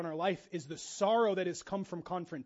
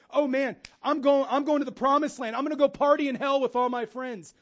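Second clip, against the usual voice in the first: loud, high, hammed-up party enthusiasm; mock excitement; 6 semitones higher, 11 dB louder than usual. Preacher mimics travelers headed to party in hell, mock excitement. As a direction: whoop it up like a deluded reveler. Mocking eager party-goers on the highway.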